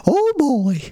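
very high cartoon voice; high squeaky voice. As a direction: pitch it way up, squeaky. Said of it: "Sounded like Mickey Mouse there".

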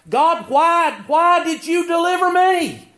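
high, loud, wondering outcry; astonished cry to God. Acted. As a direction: cry out in grateful disbelief. Saved man cries out to God.

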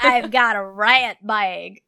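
slow, loud Texan drawl; Texas accent. Pretend. Host plays along with co-host's teasing, slow Texas accent. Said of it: "You sound like you're from Texas".

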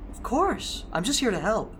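high, sugary, innocent voice; sweet charming voice. Acted. Charming Evan answers sweetly.

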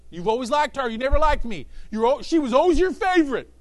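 jealous sibling. Retold or imitated. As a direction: whine it at a brother.

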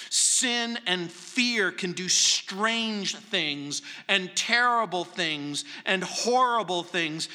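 usual speaking voice

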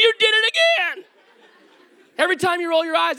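high, fast, exasperated cry; exasperated complaint. Retold or imitated. Husband calling out his wife's eye roll, high and exasperated. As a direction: pitch it up in frustration, rushing the words. Retelling argument with wife.

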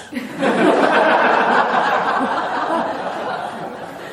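long high airy laugh; high breathy laugh. Real, not acted. Monk laughing at his own airport joke.

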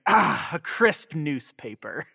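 slow, contented sigh over the paper; slow satisfied dad. Retold or imitated. Dad settling in with paper, slow contented voice. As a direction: savor it like a cozy old dad. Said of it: "And you just think".

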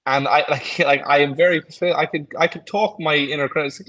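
ordinary talk

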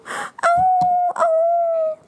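very high, wailing puppy yelp; crying dog howl. Acted. Dog hit by thrown wood, high crying howl. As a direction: howl in pain like a little dog. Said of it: "大声地哭说".

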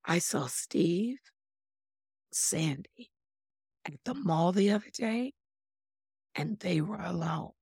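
hushed and slow with pauses, hinting at gossip; quiet insinuating gossip tone; soft, slow, suggestive. Shown as a demonstration. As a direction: drop to a knowing hush, pausing between names. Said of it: "listen to the second one".

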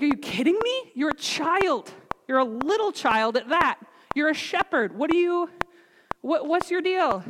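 high-pitched mock disbelief; acted-out royal incredulity; incredulous king. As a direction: question the kid in high, sputtering disbelief. King incredulous at child volunteer.

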